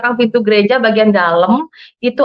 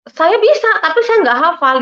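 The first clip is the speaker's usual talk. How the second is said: high-pitched, defensive excuse; retold flustered excuse; 9 semitones higher than usual. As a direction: plead your case when caught unprepared. Flustered answer when tested.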